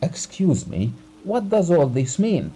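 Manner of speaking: sharp, rising indignant question; affronted demand. A narrator's reading. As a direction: bristle at the insult. Abogin flushing red, affronted.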